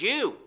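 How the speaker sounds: YOU lifted high at the end, pointing to believers; emphatic you. Shown as a demonstration. Preacher stressing you to his listeners. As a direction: land on you as the point of the verse. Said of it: "is emphatic".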